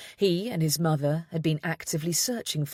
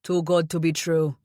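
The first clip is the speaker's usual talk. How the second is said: a hint of grim Jamaican grandmother; darkly, in her Jamaican accent; 3 dB louder than usual. A narrator's reading. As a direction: grandmother speaking darkly of the con woman. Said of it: "his mother said darkly".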